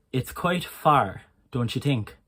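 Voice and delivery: Irish accent with breathy T and "tink" for think. Shown as a demonstration. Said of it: "To demonstrate that".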